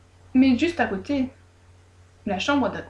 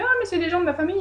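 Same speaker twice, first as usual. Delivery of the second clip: vieille femme qui se défend; 8 semitones higher, much faster than usual. A narrator's reading.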